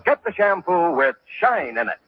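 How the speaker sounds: usual speaking voice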